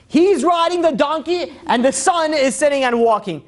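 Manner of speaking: loud, high-pitched indignation, drawn out; scandalized onlooker calling out a disgrace; outraged passerby. Acted. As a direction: cry it out in mock outrage. Outraged passerby acted in story.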